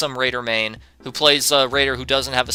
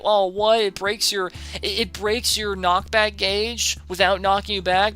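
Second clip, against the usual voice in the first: hammed-up whiny gamer begging for a nerf; mock whiny raider main; 8 semitones higher than usual. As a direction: imitate a crybaby complaining about balance. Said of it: "they'd probably be whining about that".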